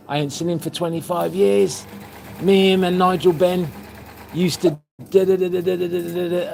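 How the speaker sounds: high, animated imitation of the shopkeeper; excited retelling. Shopkeeper hearing of an old pal, excitedly imitated. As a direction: gabble it high, trailing into da-das.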